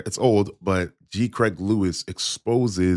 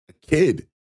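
high, stunned repeat; indignant emphasis; 10 semitones higher than usual. Real, not acted. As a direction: exclaim it in disbelief. Outraged repeat of "kid.

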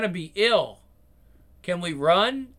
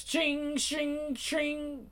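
high metallic ching ching; high chain clinks; 9 semitones higher, 7 dB quieter, much slower than usual. Acted.

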